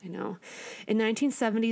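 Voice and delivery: normal talking voice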